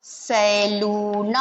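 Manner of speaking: सैलून drawn out with a full ऐ; slow careful demo. Shown as a demonstration. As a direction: stretch सैलून slowly. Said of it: "स पे ऐ की मात्रा सही पढ़ते हैं".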